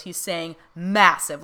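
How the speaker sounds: emphatic massive, mild mock scream; stress on massive. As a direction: lean hard on massive. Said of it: "he's screaming massive in my face".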